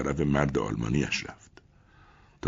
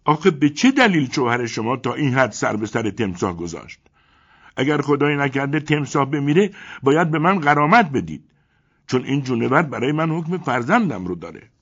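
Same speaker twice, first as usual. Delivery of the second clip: loud, higher harsh scolding; 9 semitones higher, 7 dB louder than usual. A narrator's reading. German man rebuking Elena, harsh and loud. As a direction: snap at her roughly. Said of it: "با خشونت گفت".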